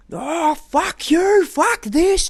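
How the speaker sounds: imitated high-pitched screaming outburst; going ballistic. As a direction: yell it high at the top of your lungs, imitating a meltdown. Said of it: "just top of someone's lung just going ballistic".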